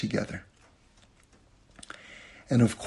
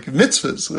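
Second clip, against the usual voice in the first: ordinary delivery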